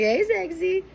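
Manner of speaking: high-pitched playful mock flirt; flirty. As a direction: put on a high, teasing come-on. Voicing a flirty greeting.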